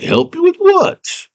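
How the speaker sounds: high, drawn-out puzzled retort; little goblin man voice. Acted. Goblin man answering the girl, high slow character voice. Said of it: "He's like".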